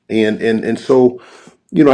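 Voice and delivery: normal talking voice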